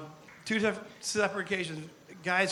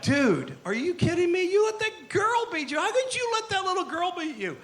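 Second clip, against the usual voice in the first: much higher, mocking bro voice; incredulous buddy; 11 semitones higher than usual. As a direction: rib your friend for losing to a girl. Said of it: "He's like".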